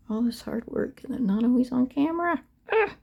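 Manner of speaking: normal talking voice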